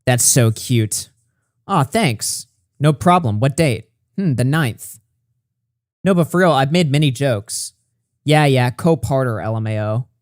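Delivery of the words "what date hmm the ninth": ordinary talk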